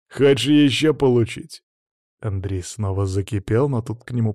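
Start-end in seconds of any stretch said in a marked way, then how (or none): 0.1-1.6 loud, arrogant taunt; haughty mocking. A narrator's reading. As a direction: sneer it as a challenge. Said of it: "усмехнулся высокомерно и издевательски".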